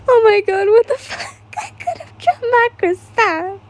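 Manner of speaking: high shrieking panic; screaming. Acted. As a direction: shriek it out in shock. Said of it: "You screamed at the robot".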